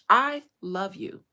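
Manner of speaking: slow, loud, pressing each word; emphatic I love you. Retold or imitated. Daughter pleading with her father, loud and slow. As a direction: plead it hard so he finally hears. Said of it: "That's what I told him".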